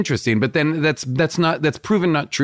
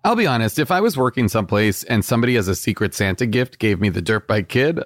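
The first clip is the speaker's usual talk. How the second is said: ordinary talk, nothing marked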